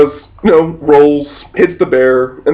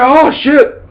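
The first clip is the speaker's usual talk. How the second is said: high startled cry; shocked oh shit; 10 semitones higher than usual. Retold or imitated. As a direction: caught off guard by the claw. Fighter gets swiped.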